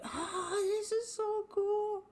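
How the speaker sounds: high, slowed, breathless wonder; awed delight. Retold or imitated. Becoming the ocean, she gasps in high amazement. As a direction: marvel at it like a child. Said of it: "And I'm like".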